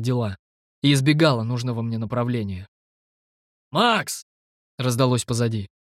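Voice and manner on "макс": high call from behind. Acted. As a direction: call the name across. Someone calls from behind.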